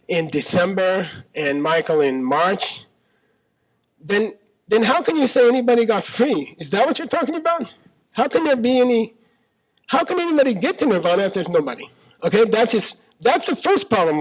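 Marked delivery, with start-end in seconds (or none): 4.0-14.2 high-pitched, incredulous questioning; exasperated challenge. Acted. Disciple challenging Lord Buddha on nirvana, exasperated and incredulous. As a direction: argue back, voice climbing in disbelief.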